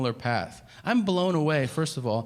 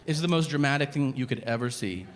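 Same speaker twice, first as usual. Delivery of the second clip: normal talking voice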